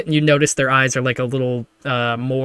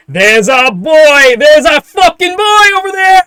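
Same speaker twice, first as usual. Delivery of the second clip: rowdy cheering; 13 semitones higher, 12 dB louder than usual. Acted. Men of the town hail their boy, rowdy cheering shout. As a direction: holler it like a proud mate in a crowd.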